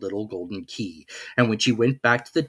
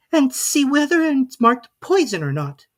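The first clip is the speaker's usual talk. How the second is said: put-on a careful girl insisting; Alice's voice; 6 dB louder than usual. A narrator's reading. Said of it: "she said".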